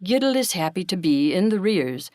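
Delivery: ordinary delivery, nothing marked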